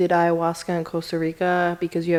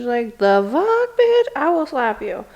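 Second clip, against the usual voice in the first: louder slow threatening snap; mock tough retort; 7 semitones higher, 5 dB louder, slower than usual. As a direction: square up to a mean girl. Angry retort to mean girls.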